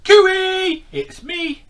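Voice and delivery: high, very loud joyful call to the shoal; calling out as Stanley. Acted. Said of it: "he called".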